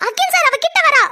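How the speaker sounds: high shriek of alarm; panicked shriek. Acted. Girl shrieks to Akil as her sister approaches, panicked high cry. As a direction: scream that she's coming. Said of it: "ஐயோ, கத்தாத வினோ".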